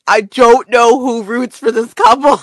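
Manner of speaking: emphatic, worked-up exclamation; exasperated outburst. Real, not acted. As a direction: belt it out in utter disbelief. Repeats it in loud exasperation.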